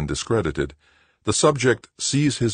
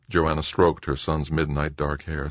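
plain reading voice

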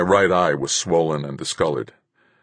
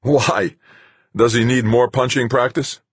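loud, higher bitter bark; bitter laugh; 8 semitones higher, 6 dB louder than usual. A narrator's reading. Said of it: "bark out a bitter laugh".

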